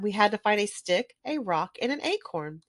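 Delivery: ordinary delivery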